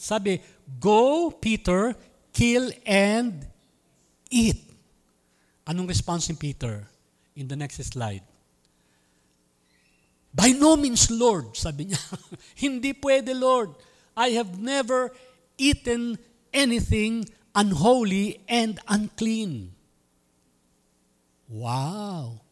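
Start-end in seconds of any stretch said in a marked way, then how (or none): 0.8-4.6 voice from heaven. Acted. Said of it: "may narinig siyang voice sa langit". 10.3-11.5 raised, higher pleading protest; Peter's protest. Acted. Peter protesting to the Lord, raised high and loud. 12.7-19.7 protesting. Acted. Peter protesting to God.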